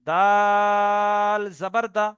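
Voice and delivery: "দাল" drawn out very long and level; model elongated spelling. Shown as a demonstration. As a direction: hold "দাল" on one steady tone, then finish the spelling. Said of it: "একটা উদাহরণ দিয়ে বুঝিয়ে দিচ্ছি". ("দাল"-এ লম্বা টান দিয়ে বানান)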